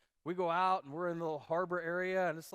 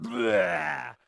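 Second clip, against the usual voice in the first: sudden loud retching "blah"; loud imitated puke noise; mock vomiting; 9 dB louder than usual. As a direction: burst out as if throwing up. Acts out his friend throwing up.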